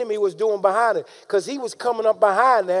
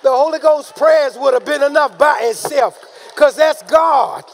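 loud, raised, emphatic sermon delivery; forceful preaching; 3 semitones higher, 5 dB louder than usual. Real, not acted. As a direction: preach it out, slowing for the big words.

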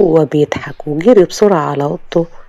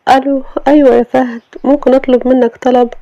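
put-on higher young woman asking a favour; Sama on the phone; 8 semitones higher than usual. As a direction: ask the driver politely, voice raised high.

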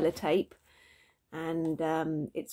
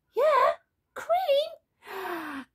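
very high, teasing squeal; gleeful insistence. A narrator's reading. Dad answers baby's refusal, high and gleeful. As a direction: pipe it up high, stretching the last word.

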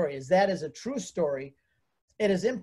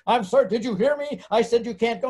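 imitating a snappy, higher, louder official; mock the counselor's indignant no; sharp refusal; 4 semitones higher, 5 dB louder than usual. Counselor refusing his permits, hammed-up indignant retort.

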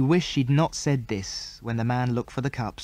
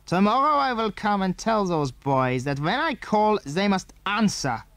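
stern raised man's threat; angry voice; 6 semitones higher than usual. A narrator's reading. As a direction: warn them, raised and stern. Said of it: "said the man in an angry voice".

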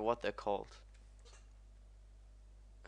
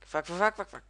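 high, loud burst of curses; panicked swearing; 8 semitones higher, 7 dB louder, faster than usual. Real, not acted. Gamer about to crash, panicked cursing.

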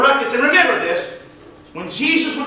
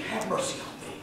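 hushed plea; 15 dB quieter than usual. Acted. Blind man asking for mercy, hushed near-whisper. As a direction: drop almost to a whisper.

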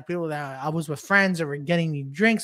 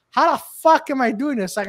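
usual speaking voice

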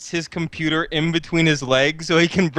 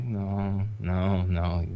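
much softer, low no no; shy refusal; 9 semitones lower, 7 dB quieter, much slower than usual. Acted. August turning down Mila, soft and low. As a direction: murmur it while looking away. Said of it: "he'll just look away".

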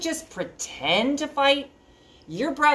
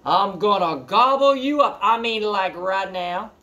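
louder half-hearted troll threat; 3 semitones higher, 6 dB louder than usual. Acted. Troll trying to scare the biggest goat, loud. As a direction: force out a threat.

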